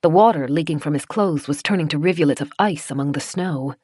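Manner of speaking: ordinary delivery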